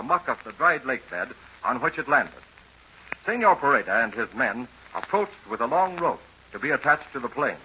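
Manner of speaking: low, soft story narration; story recap. A narrator's reading. Narrator recaps the serial for young listeners, low and soft. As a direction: draw the listener back into yesterday.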